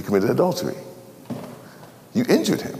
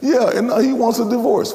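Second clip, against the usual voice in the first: higher complaining woman; whiny woman; 13 semitones higher, 3 dB louder than usual. Acted. Woman at bus stop, higher and whining. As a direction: complain it high.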